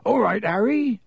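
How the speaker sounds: high-pitched, cheery big-man greeting; Hagrid, hearty. A narrator's reading. Groundskeeper greeting Harry in the corridor, hearty. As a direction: hail him heartily.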